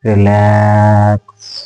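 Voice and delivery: long, level, very slow stretched vowels; drawn-out relaxation cue. Shown as a demonstration. Yoga teacher easing listeners into relaxation, long drawn-out cue. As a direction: let the word stretch out evenly and settle.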